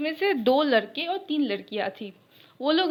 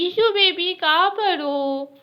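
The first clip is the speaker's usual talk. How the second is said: put-on high, loud, drawn-out call for her girlfriend; sing-song call; 7 semitones higher, 7 dB louder, slower than usual. Fiancée calling for her sweetheart across the party, sing-song. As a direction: lilt the pet name out, slowly.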